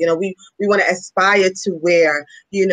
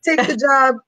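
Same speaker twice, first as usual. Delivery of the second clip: lightly imitating a Caribbean mom, higher; Caribbean mother's voice, pitched up high; Caribbean accent; 8 semitones higher, slower than usual. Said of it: "with her heavy Caribbean accent".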